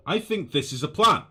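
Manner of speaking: excited. Real, not acted. Excited about a big build plan.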